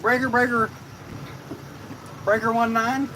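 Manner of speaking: loud trucker radio lingo; CB radio call. Pretend. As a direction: hail the channel like a trucker.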